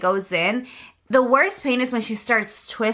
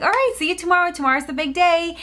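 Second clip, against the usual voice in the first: higher, upbeat sign-off in a mock nurse voice; cheerful nurse; 7 semitones higher, faster than usual. Nurse telling mom the induction is tomorrow, perky and high. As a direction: chirp it out like a happy nurse hanging up.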